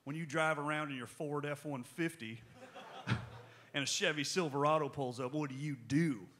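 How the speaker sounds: hammed-up American truck-country accent; fake American accent. Shown as a demonstration. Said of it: "put on a fake American accent".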